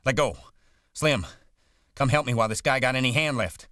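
high, loud, frantic shouting; desperate repeated commands. A narrator's reading. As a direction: shout for him to stop, then for help. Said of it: "George shouted over and over".

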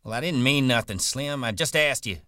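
worked-up backpedalling; defensive protest. Acted. Curly defensively answering an angry Slim.

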